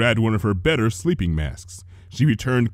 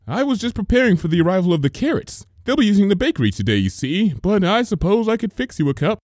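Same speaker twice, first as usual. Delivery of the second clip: cheery greeting; 9 semitones higher, faster than usual. A narrator's reading. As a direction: call out brightly as a baker. Missus Cake voiced.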